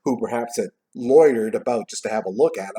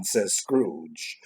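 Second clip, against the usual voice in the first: usual speaking voice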